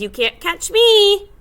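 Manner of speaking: high, loud singsong taunt; gingerbread man taunt. Acted. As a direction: tease the fox like a cheeky cookie.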